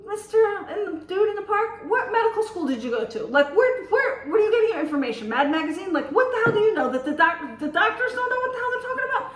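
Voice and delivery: high-pitched incredulous challenge; mock-exasperated comeback; retold indignant retort. Retold retort to the man's tirade.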